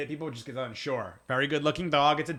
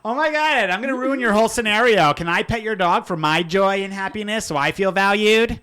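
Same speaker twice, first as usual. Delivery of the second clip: high, loud gushing dog-lover voice; mocking gushing stranger; 8 semitones higher, 10 dB louder than usual. Mocking a stranger who interrupts his coffee, high and loud. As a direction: parody a needy stranger begging to pet the dog. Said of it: "that's what people are really doing".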